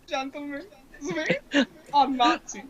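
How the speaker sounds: high, put-on villain voice; villain impression. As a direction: do the Major's big speech. Imitating the Major's speech.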